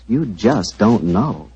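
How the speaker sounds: drawn-out, very slow emphasis; slow, knowing sales emphasis; slow emphatic. Acted. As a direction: let every word land slowly. Ad pitch repeated line.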